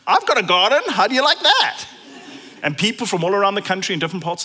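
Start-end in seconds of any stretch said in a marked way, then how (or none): 0.1-1.8 bright, louder, laughing brag; playful boast. Retold or imitated. God boasting to people about the flowers, laughing. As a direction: show off with a laugh. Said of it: "God just goes".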